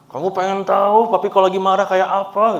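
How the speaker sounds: a playful hint of an angry dad; mock snapping father. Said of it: "snap gitu". (ayah pura-pura membentak, nada lebih tinggi)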